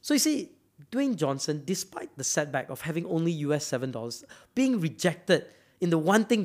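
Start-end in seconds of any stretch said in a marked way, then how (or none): none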